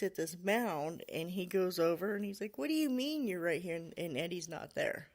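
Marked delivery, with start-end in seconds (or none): 2.6-3.8 higher-pitched incredulous question; retold puzzled disbelief; baffled retort. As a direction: mimic a boy who can't believe it. Confused boy challenges Eddie's claim.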